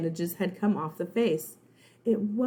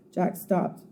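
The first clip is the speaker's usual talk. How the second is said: plain reading voice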